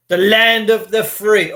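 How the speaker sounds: slow, mocking grandeur. Mocking his viewers with a slow grand slogan. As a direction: roll it out like a patriotic slogan. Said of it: "I do get a giggle when I start saying words like that".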